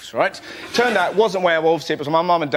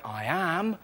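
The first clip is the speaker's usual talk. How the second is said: deadpan reply; 7 semitones lower, 10 dB quieter, slower than usual. Retold or imitated. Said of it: "this hero looked back at this bloke and went".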